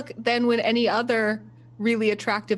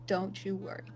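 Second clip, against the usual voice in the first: gentle, lowering to a soft close; tender reassurance; 7 dB quieter than usual. Acted. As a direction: soothe a child-like crewmate. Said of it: "She takes his cheeks and she's like".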